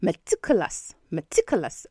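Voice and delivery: "meticulous" as muh-TICK-kuh-luhs, said twice; model pronunciation. Shown as a demonstration. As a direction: stress the tick in "meticulous", other vowels schwa. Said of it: "the stress is on the next syllable".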